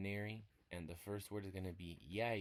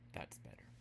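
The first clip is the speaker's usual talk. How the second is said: low unvoiced whisper; quiet relieved whisper; 10 semitones lower, 7 dB quieter than usual. Real, not acted. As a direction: murmur it to yourself. Whispered after correcting.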